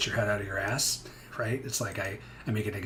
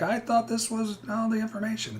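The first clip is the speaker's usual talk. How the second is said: much higher put-on complaining buyer voice; whiny customer; 12 semitones higher than usual. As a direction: mimic a customer griping that he was shortchanged.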